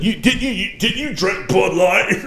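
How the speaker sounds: laughing mock brother. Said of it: "my little brother tried to call me out".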